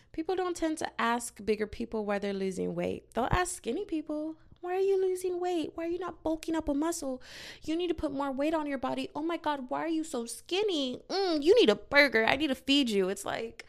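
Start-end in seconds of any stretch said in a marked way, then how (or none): 4.6-13.1 high-pitched fussing voice; imitating pushy, pitched-up concern; nagging busybody. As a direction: fuss over them like a meddling relative. Mimics people nagging skinny people.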